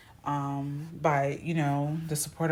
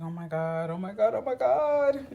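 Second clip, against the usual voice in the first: oh my God climbing higher each time; mock panic rising higher; 6 semitones higher than usual. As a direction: panic builds over work tomorrow. Panic before work.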